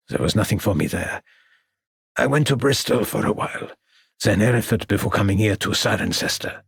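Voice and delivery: lightly voiced weary old man; old French artist. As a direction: play the tired exile.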